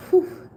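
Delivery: short high whew; steadying exhale. Real, not acted. Streamer composing self before rereading, relieved whew. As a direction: blow out the tears in one high breath. Recovering from crying.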